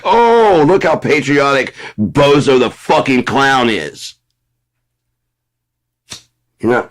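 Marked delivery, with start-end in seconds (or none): 0.0-4.1 much higher, slow, gushing sarcasm; mock admiring fan. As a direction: squeal out fake admiration of the clown. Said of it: "everyone's like".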